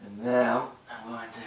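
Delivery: strained talking. Real, not acted. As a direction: talk while folded over, straining. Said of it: "That's a hard position to talk in".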